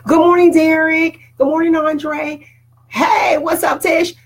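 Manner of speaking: high, loud, bright hellos to viewers; real excited welcome shout; excited greetings. Real, not acted. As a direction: call out each name with delight. Greeting viewers joining live.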